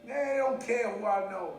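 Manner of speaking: ordinary delivery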